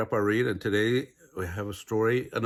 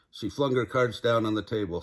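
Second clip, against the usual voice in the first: ordinary delivery, nothing marked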